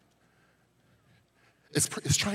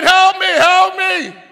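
high, loud, slow plea; pleading cry; 14 dB louder, much slower than usual. Retold or imitated. Beaten man begging the assistant, slow loud plea. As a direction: drag out Help me like a dying man.

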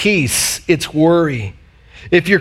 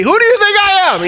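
high squeaky mock outrage; hammed-up high-pitched indignation; mock indignant spouse; 3 dB louder, much faster than usual. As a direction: squeal it like the put-upon one at the sink. Imitating frustrated dish-washer.

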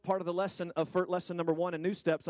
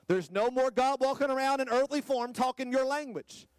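full-throated pulpit preaching, pitched up high; preaching at full force; 9 semitones higher than usual. Acted.

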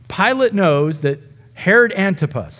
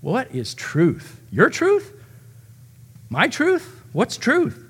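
mocking, each truth tossed up higher; sarcastic doubt; 6 semitones higher, 3 dB quieter, much slower than usual. Pilate mocking Jesus' claim to truth, sarcastic rising questions. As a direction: drawl it slowly, then flick the questions up high. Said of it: "It's filled with sarcastic doubt".

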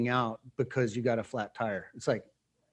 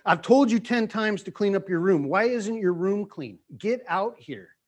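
higher, louder acted scolding; angry jerk parent; 6 semitones higher, 4 dB louder than usual. Dad to daughter about her messy room, angry scolding. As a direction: play a parent taking work anger out on a child. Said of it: "I'm gonna be a real jerk".

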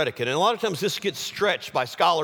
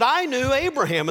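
insistent claim; 10 semitones higher, 4 dB louder than usual. Acted. Acted argument with Pharisees.

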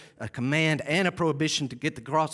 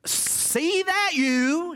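much higher, very slow, "see that you" stretched in dawning surprise; startled realization; 14 semitones higher, 3 dB louder, much slower than usual. Retold or imitated. Listener catching the verse's meaning, stretched high in surprise. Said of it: "You're like, "Wait".

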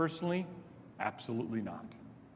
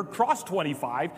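usual speaking voice

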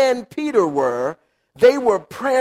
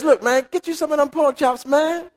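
casual God voice; 6 semitones higher than usual. Retold or imitated. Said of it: "And God says".

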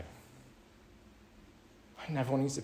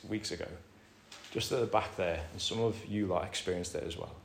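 ordinary delivery

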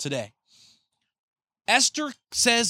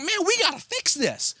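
higher, urgent resolve; urgent king; 9 semitones higher than usual. Acted. King to his attendant about honoring Mordecai, urgent and higher. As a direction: insist it must be fixed now.

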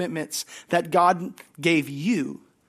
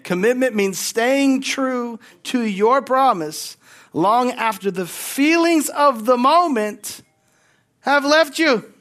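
louder, higher and slower, building to a cry; emphatic preaching; 7 semitones higher, 7 dB louder, slower than usual. Shown as a demonstration. Pastor repeating the line to the congregation, louder and slower. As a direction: drive it home like a preacher, slowly. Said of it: "I'm gonna say it again".

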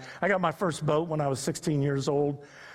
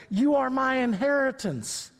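tender divine declaration; 8 semitones higher, slower than usual. Acted. Voicing God's words to believers.

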